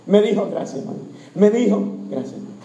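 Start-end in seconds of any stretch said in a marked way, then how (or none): 0.5-1.0 very soft, near-whispered thanks; barely voiced quick thanks; hushed aside. Real, not acted. Real aside thanking a helper. 2.1-2.5 very soft, low thanks; quick murmured thanks; hushed aside. Real, not acted. Real aside thanking a helper.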